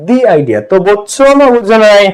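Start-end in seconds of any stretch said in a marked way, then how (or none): 0.1-0.6 'the idea' with "the" as "dee"; 'the' with a long ee vowel; correct "the". Shown as a demonstration. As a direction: say 'the' as "dee" before idea. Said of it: "vowel থাকলে উচ্চারণ হয় the".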